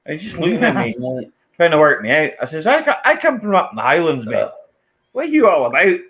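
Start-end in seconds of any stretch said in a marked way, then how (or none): 2.7-6.0 higher-pitched challenge, retold; acted-out indignant comeback; indignant retort. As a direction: throw the challenge back at a nosy stranger. Acted retort to confrontational stranger.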